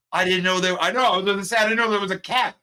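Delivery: high, loud squeal of disbelief; hammed-up astonished falsetto; mock shocked. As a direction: play up the shock, way up in the voice. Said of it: "I was like".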